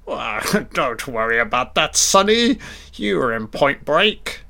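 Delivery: a lightly put-on voice of an old director, chuckling; a hint of a jolly old director, with a high "Sonny"; mock director. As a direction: reassure the young actor breezily. Voicing the director reassuring Keanu.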